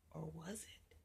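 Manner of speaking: hushed spooky aside; ominous whisper. A narrator's reading. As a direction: whisper it with suspense.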